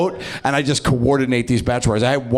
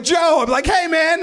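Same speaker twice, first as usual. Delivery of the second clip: much higher, bright mock greeting; fake-friendly greeting. Comic mimics himself greeting strangers, high overeager squeal.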